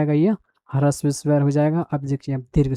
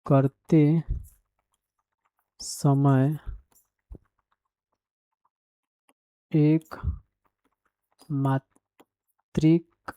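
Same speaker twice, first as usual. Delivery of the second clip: slow, word-by-word dictation, softer; 4 dB quieter, much slower than usual. Shown as a demonstration. Teacher dictating the definition to students, slow and measured. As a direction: spell it out slowly so students can copy.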